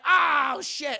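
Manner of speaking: high, loud mock dismay; dismayed outburst. Indy realises he's betrayed, dismayed outburst. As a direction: let it burst out high.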